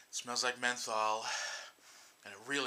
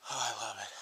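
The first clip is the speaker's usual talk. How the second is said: normal talking voice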